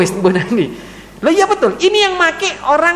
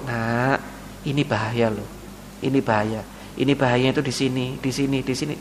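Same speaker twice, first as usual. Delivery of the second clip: low, soft fatherly caution; soft, lower-voiced parent explaining danger; gentle warning; 10 semitones lower, 9 dB quieter than usual. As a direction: calmly warn the child, no anger. Parent gently warns child.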